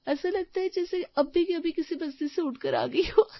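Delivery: usual speaking voice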